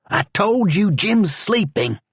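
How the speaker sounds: high, sharp retort; deputy silencing the prisoner; Charlie's voice. A narrator's reading. Charlie threatening George.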